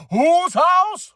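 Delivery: loud high chant; catchphrase call. Pretend. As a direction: shout it like a crowd call.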